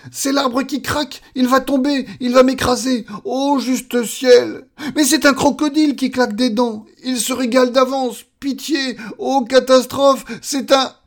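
high, loud escalating alarm; hysterical panic. Acted. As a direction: scream one disaster after another. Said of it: "hurla-t-il". (hurlements de panique, très aigus)

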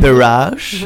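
put-on drawn-out station name in English accent; exaggerated anglicised pronunciation; mock English accent. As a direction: stretch it slowly like an English-speaking tourist. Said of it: "il fallait savoir parler anglais".

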